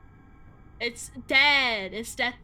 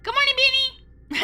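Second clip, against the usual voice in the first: cheery greeting; 12 semitones higher, 8 dB louder than usual. Real, not acted.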